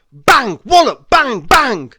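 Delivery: loud, punchy sound-effect shouts; voiced drum bangs. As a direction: bash out each word like a drum hit. Narrator voices drum noises.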